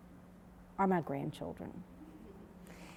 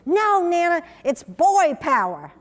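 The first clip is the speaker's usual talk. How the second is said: imitating a toddler, higher and louder; two-year-old's protest; 6 semitones higher, 6 dB louder than usual. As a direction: insist like a small boy. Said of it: "her little two-year-old boy says".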